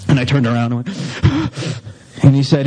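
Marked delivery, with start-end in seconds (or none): 0.9-1.9 sharp, high mock gasp; startled gasp. Turning round when the boss calls, startled gasp. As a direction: catch the breath in dread.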